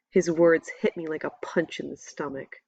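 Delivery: usual speaking voice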